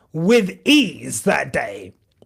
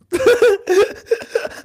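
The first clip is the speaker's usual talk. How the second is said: teary laugh; 12 semitones higher than usual. Real, not acted. Teary laugh after crying remark.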